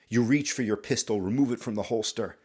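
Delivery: usual speaking voice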